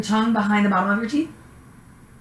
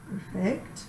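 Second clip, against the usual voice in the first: normal talking voice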